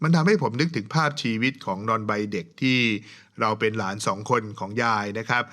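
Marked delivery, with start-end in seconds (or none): none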